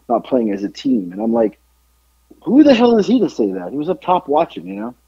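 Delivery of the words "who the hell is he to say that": higher-pitched, affronted question; indignant recap of your own reaction; retold indignation. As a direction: challenge it in mock outrage, pitched well up. Retelling indignant reaction to cop.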